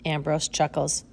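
usual speaking voice

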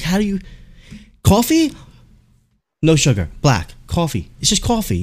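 high, indignant customer imitation; mock angry yell. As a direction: squeak out outrage over a simple order. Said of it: "He's yelling at the guy".

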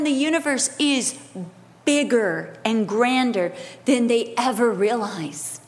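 ordinary delivery

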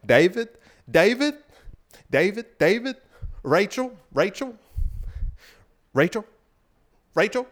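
high rising sing-song calls, repeated; lightly mock the dad's lilting call of each name; imitating dad calling names. Son mimics how his dad calls his kids' names, high sing-song. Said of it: "the way my dad says my name and my sister's name".

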